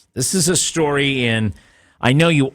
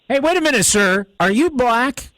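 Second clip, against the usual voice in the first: a jokey, much higher voice playing the stern supervisor; mock supervisor; 10 semitones higher than usual. Host playing the supervisor, questioning the caller, hammed-up high voice. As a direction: ham up the cop's boss checking on you.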